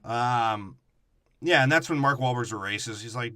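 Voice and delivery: normal talking voice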